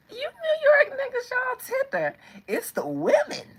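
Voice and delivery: loud, high, playful squeal; high-pitched teasing. Pretend. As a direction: tease them in a squealing coo. Teasing New York men.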